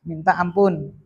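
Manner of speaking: ordinary talk, nothing marked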